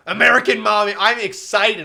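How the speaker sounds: loud, eager outburst; excited. Real, not acted. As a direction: burst out with real excitement. Excited gamer reaction.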